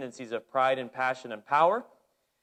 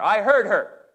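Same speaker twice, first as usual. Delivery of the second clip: louder, pointed replay of the ref; ref's line repeated; 5 semitones higher, 7 dB louder, slower than usual. Retold or imitated. Preacher replaying the referee's words, loud and pointed. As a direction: make them hear those words. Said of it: "let that ring in your ears".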